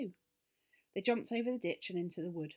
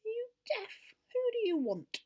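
high, cross rabbit squeak, louder on who; 5 dB louder than usual. Acted. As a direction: snap at the children as a grumpy rabbit. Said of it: "rather crossly".

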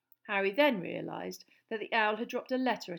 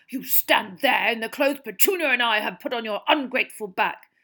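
loud furious uncle; 3 semitones higher, 11 dB louder than usual. A narrator's reading. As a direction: rage at the boy. Vernon raging at Harry.

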